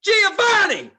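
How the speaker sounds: high piercing yell of a name; retold yell. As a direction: scream the name right into someone's ear. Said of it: "and yelled".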